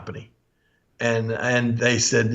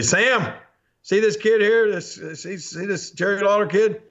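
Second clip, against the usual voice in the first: teasing Jackie Fargo; 7 semitones higher than usual. Retold or imitated. Fargo teasing the cook about the kid, in a raised mock-boss voice. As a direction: ham up the boss needling his cook.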